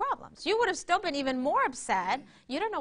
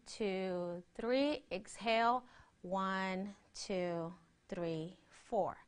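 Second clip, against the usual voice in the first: slow soft counting, trailing low; calm breathing coach count; guided breath count; 5 semitones lower, 7 dB quieter, much slower than usual. Acted.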